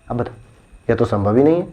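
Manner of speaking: usual speaking voice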